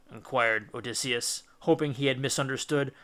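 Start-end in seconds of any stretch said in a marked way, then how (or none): none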